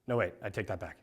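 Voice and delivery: low, soft backpedal; sheepish low aside; hushed retraction. Retold or imitated. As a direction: quietly take back a prayer, under your breath. Sudden retraction of his prayer.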